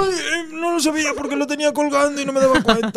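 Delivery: high, whiny mock patient; clueless patient. Mocking the patient who never noticed the broken arm, in a high whiny voice. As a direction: play the surprised fool with the broken arm.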